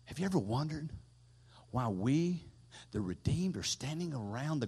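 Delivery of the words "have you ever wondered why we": normal talking voice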